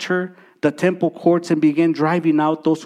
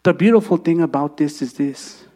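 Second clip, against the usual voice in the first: tender altar call; 3 semitones lower than usual. Acted. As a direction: draw them in gently, almost whispering. Quiet altar call, softening to a whisper.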